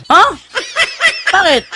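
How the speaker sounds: high surprised "Ha? Bakit?" with a laugh; mock surprise. Preacher retelling the atheist's startled reply, mock surprise.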